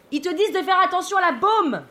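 high-pitched call from far above; yelled from aloft. Acted. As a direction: call down as if from up the mast. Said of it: "me hurle de tout là-haut".

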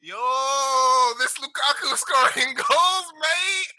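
high, drawn-out mock shouts of amazement; hyped-up mock fan. Friend imitating another's sudden praise of Lukaku, mock-hyped and high. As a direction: draw it out high, hyping up the striker. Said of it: "he goes to Inter Milan and he's like".

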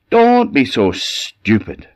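put-on father scoffing at the baby wish; scornful father. A narrator's reading. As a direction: brush off the wife's idea. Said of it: "said his father".

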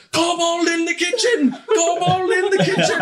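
high, loud hammed-up scream; screaming alarm. Two workers fleeing a kobold, screaming in panic. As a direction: raise the alarm at full pitch. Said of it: "the two of them downstairs scream".

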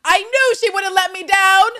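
loud, very high excited shout; shrieked, over-the-top delight; overexuberant. Acted. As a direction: imitate her gushing outburst at full voice. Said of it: "Eve's overexuberant".

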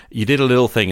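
ordinary talk

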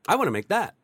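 high, loud eager wish; eager quote. Retold or imitated. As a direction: blurt it out like a sudden craving. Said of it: "and be like".

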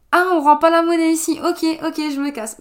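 high, loud, offended retort; mock indignant. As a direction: play it wounded and huffy. Mock reply to rude cashier.

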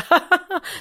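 a short high forced laugh; joining fake laugh. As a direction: laugh along without meaning it. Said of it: "skratta med så här". (kort, högt tillgjort skratt)

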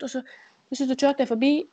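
usual speaking voice

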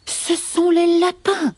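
high, slow serpent accusation; hissing snakes. Acted. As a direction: hiss the blame slowly and high. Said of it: "sifflent les serpents".

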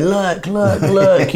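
high, pointing and fretting; look-look-look of a doom merchant; mock alarm. Comedian pointing at the sinking boat in mock alarm. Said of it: "pointing at the hole in the boat and going".

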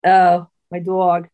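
slow, lightly mimicked New York accent; a touch of put-on New York accent; imitated New York accent. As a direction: echo it in mock New York, stretching "dog". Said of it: "imitation of a New York accent".

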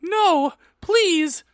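very high, loud theatrical plea; squealed mock pleading; begging. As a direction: beg in hammed-up desperation. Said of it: "I begged".

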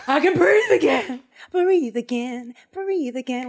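high, loud, laughing relief; joyful relief. Acted. Revived boy celebrates breathing again, laughing relief. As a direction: gasp out joy at breathing. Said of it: "it's like".

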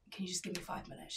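hushed whisper, quick; a pharmacy worker asking the caller for time, whispered; whispered aside. Retold or imitated. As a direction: whisper it fast under your breath. Polite phone reply retold.

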